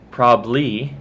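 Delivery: "probably" shortened to prob-lee; slightly rushed form. Shown as a demonstration. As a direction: drop the middle syllable of "probably". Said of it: "a little bit in a rush".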